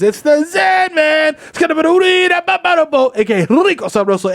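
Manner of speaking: high, slow hyped shout; hype shout. Acted. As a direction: announce yourself loud and high.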